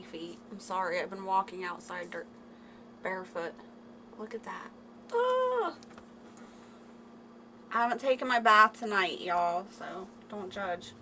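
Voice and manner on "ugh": high, squeaky groan of disgust; grossed-out ugh up high. Real, not acted. Reacting to gross toe.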